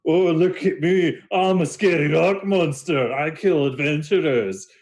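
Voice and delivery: mocking high monster impression; Dahlin. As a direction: play the scary monster as a high-pitched joke. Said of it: "like, laughing, and, and he goes".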